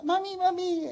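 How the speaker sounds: put-on high small child's voice calling mami; a little child tugging at mommy, unaware. Child at the lion cage calling to mother, high childlike voice.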